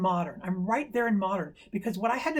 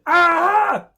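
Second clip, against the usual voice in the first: loud, high sudden scream; 10 semitones higher, 12 dB louder than usual. Retold or imitated. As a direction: imitate a boy screaming at a bug. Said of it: "got close to the insect and screamed".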